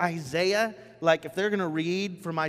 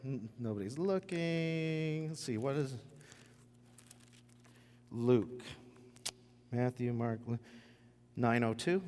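sneaky mutter; 5 semitones lower, 9 dB quieter than usual. Pretend. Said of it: "you're like".

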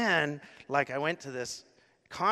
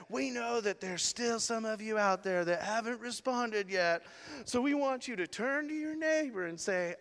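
altar-call speaker; 7 semitones higher than usual. Retold or imitated. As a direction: coax the crowd gently, imitating a preacher. Imitating the speaker on the platform.

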